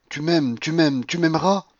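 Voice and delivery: high, loud, insistent repetition; impassioned, climbing insistence; feverish love. A narrator's reading. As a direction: repeat it like one delirious. Paulina in frenzy of love.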